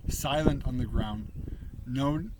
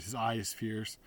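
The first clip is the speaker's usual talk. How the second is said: ordinary delivery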